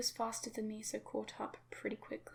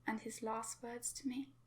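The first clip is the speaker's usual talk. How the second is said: ordinary delivery, nothing marked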